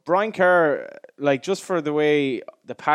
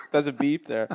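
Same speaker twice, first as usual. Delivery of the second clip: normal talking voice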